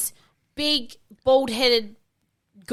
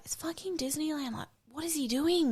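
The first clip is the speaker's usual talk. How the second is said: muttered disbelief; 5 semitones higher, 9 dB quieter than usual. Retold or imitated. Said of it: "I was like".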